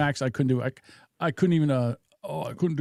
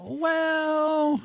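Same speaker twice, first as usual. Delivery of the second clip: long, high-pitched doubting "well"; skeptical heckle; 12 semitones higher than usual. Real, not acted. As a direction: draw the word out high to object. Skeptical heckle over played clip.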